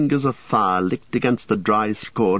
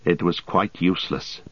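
plain reading voice

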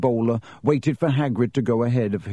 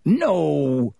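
drawn-out, higher-pitched growl; 4 semitones higher than usual. A narrator's reading. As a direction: growl it out in protest. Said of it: "growled Hagrid".

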